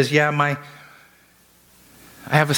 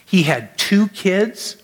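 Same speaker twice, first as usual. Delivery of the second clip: emphatic preaching; 7 semitones higher, slower than usual. Acted. Preached emphasis, later retold plainly.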